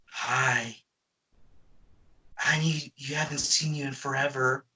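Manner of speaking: imitating Jessica Rabbit. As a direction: purr it like a cartoon femme fatale, softly. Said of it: "she would go".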